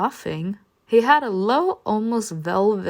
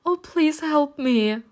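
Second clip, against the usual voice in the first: high, slow tearful plea; fake crying; 7 semitones higher, slower than usual. Narrator fakes tears before the groom, high pleading whine. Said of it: "started crying loudly".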